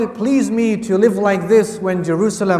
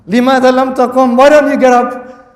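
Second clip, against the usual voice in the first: raised, demanding voice of the khalif; khalif's stern demand; 5 semitones higher, 7 dB louder, faster than usual. Acted. Khalif demanding why the scholar stays seated, loud and stern. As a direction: call him out sharply for staying seated.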